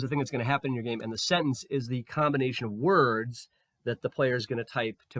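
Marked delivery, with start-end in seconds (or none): 0.0-1.0 rattled off quickly; fast. Shown as a demonstration. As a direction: rush through the repeat. Restates the same sentence faster.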